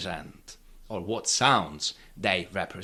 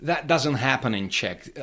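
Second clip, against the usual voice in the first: usual speaking voice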